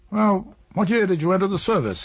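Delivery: high, loud, hearty officer's greeting; bluff military geniality. A narrator's reading. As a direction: boom it out with put-on bonhomie. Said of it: "affectation of bluff military geniality".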